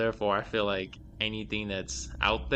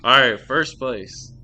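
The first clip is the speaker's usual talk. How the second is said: ordinary talk, nothing marked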